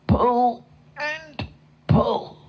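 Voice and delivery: high, effortful, separated words; straining pull. A narrator's reading. Narrator showing the horse hauling, straining. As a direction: heave on each word as if tugging.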